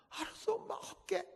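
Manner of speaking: high breathless whispered surrender; frightened whisper. Retold or imitated. Cowed daughter promises her mother in a high whisper.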